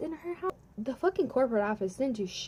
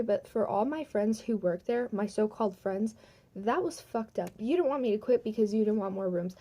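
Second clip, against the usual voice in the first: ordinary delivery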